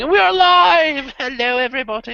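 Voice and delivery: stretched, high-pitched hello to the audience; show-opening call. Real, not acted. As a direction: belt out a live-show greeting. Host opens the live show.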